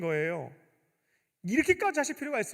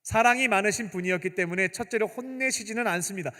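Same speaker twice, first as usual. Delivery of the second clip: ordinary talk, nothing marked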